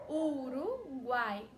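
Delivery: Uruguai drawn out very slowly, vowels held; teacher showing the triphthong; slow demo. Shown as a demonstration. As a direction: spell Uruguai out slowly in sound. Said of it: "ó, U A Y".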